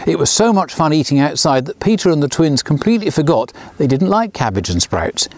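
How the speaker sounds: ordinary delivery, nothing marked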